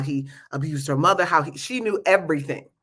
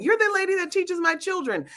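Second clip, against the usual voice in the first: high, bright-eyed eager voice; high excited mother; 12 semitones higher than usual. Retold or imitated. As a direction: gush it up high like an eager fan. Mother chasing her at airport.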